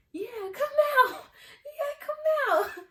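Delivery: high, loud laughing call; egging her on through laughter; laughing taunt. Retold or imitated. Joins in, laughing.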